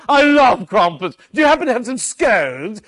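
a dad playing a grand English gentleman at his daughter's pretend tea, high and loud; hammed-up English tea guest. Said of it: "I said".

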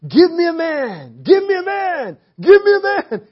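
Goliath taunting every morning, rising shout; giant's shout. Retold or imitated. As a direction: roar it, then break up laughing. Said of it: "the giant comes out".

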